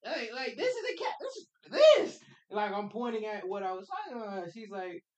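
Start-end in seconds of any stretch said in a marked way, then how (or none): 0.0-2.2 high, loud insistent explaining; pleading innocence, pitch way up; excited protest. Retold or imitated. Kid insisting to the teacher it means cat, high and loud.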